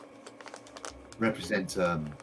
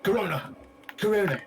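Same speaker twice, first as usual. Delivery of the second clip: mock dramatic; 11 semitones higher than usual. Joking diagnosis.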